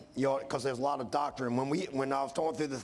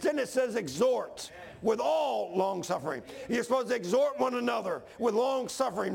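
full-force preaching; 4 semitones higher than usual. Acted. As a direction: preach it out with rising fire.

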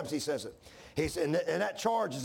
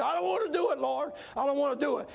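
much higher pleading cry to the Lord; retold crying plea; 9 semitones higher than usual. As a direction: imitate yourself begging through tears. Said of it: "weeping and crying".

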